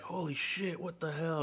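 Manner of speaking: high-pitched mock shock; astonished kid. Man recalls hearing uncle's space explanation, high mock astonishment. Voicing his childhood reaction to uncle.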